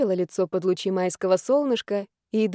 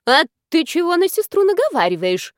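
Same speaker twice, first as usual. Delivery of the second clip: put-on old woman snapping, higher and louder; flaring up; 8 semitones higher, 5 dB louder than usual. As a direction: flare up at the granddaughter. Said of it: "взвилась баба Нюра".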